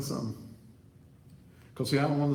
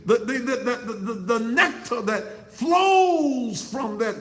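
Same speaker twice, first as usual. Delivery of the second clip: full-force preaching; 9 semitones higher, 5 dB louder than usual. Acted. Preacher hollering at the women in the pews, full-force preaching. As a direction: preach it at the congregation like a revival climax.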